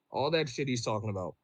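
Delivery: ordinary talk, nothing marked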